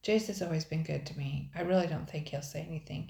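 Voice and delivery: plain reading voice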